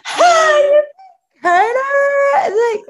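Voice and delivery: loud, high, drawn-out mocking cry; exaggerated imitation of teasing astonishment; mock big deal. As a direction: stretch it out loud, making a huge fuss. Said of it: "they make such a big deal".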